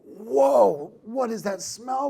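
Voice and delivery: loud, high, drawn-out mock recoil; acted disgusted shock. Restaurant expert walking into a failing restaurant, mock appalled.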